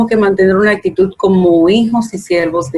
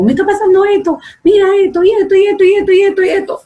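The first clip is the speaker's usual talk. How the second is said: much higher, rattling off a list; mock breathless rant; 11 semitones higher, faster than usual. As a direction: pour it all out to mom like an excited daughter. Yo le digo de todo. (hija que le cuenta todo a su mamá, voz muy aguda y acelerada)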